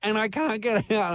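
high, lightly panicked voice; a hint of trapped panic; panicked woman. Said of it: "she goes".

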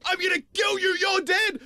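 high, strained mock yell; imitated man yelling. Man threatening a spider, imitated crazed yelling. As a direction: yell the threat in a hammed-up frenzy. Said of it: "a man yelling".

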